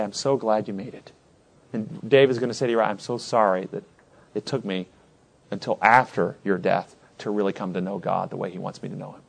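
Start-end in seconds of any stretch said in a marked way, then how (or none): 0.0-1.1 tender welcome. Acted. David greets Uriah with love. 2.9-9.2 soft sincere apology. Acted. David apologizes to Uriah.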